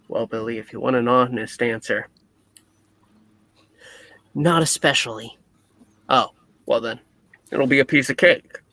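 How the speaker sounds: ordinary talk, nothing marked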